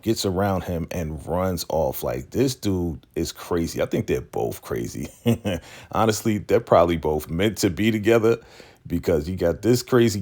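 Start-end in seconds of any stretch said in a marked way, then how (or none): none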